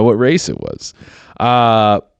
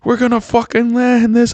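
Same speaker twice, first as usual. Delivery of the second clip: frantic. Retold or imitated. Imagined crew during crash landing.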